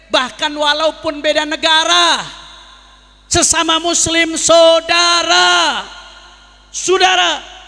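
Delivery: rising pulpit fervour, near shouting; fiery preaching. Acted. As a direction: rouse the crowd like a preacher at a rally.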